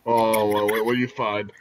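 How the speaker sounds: ordinary talk, nothing marked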